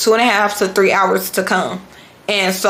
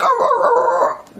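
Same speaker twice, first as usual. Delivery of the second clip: high-pitched repeated mock yelps; mimicked string of high cries; imitated yelping; slower than usual. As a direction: yelp "oh" over and over, very high. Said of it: "he's gonna be like".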